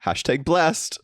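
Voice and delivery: high, lilting influencer sign-off; mock influencer. Host mocking fish selfies, high influencer chirp. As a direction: chirp it like a smug influencer post.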